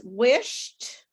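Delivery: "wished" said slowly with a final T; final T sound. Shown as a demonstration. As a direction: end "wished" on a crisp T, no extra syllable. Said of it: "which of the words sound like T".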